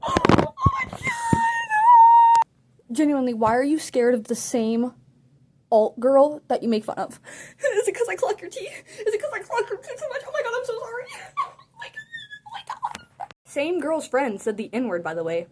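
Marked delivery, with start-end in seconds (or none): 0.1-2.5 very high, long drawn-out squeal; shocked squeal. Real, not acted. To the viewers, squealing in shocked delight. Shocked reaction to girls fleeing. 7.6-13.3 high, giggling through the words; laughing taunt. Real, not acted. To the girls, giggling high teasing taunt.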